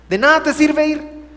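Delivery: loud, high rebuke; preacher scolding a churchgoer who never changes, loud and high. Acted. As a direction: snap at the listener.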